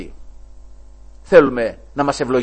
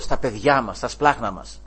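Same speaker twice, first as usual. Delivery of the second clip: ordinary delivery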